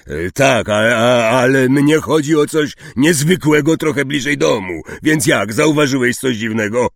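exasperated forceful questioning; impatient. A narrator's reading. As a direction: push him to get to the point. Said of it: "z wyraźną niecierpliwością".

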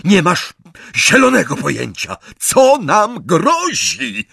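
high, loud, furious screaming; screamed order. A narrator's reading. Bellatrix overrules sister about the danger, screaming. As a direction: scream at her in exasperation. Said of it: "wrzasnęła Bellatrix".

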